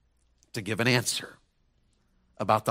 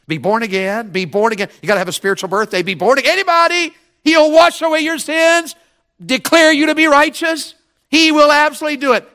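much higher and louder, preaching at full force; 14 semitones higher, 10 dB louder than usual. Acted. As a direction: lift the congregation with a ringing altar call. Preaching climax.

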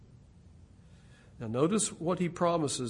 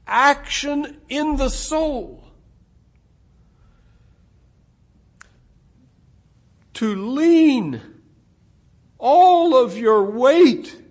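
louder, higher, slow and deliberate preaching; rising preaching; 8 semitones higher, 10 dB louder, slower than usual. Real, not acted. Preacher urging his congregation, rising and forceful. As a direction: build the sermon to full force.

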